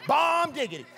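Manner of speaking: high, loud, punchy reply; big, proud exclamation; exuberant catchphrase. Retold or imitated. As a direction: belt out the catchphrase with gusto. Acting out his catchphrase reply.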